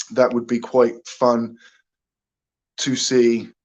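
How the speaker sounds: usual speaking voice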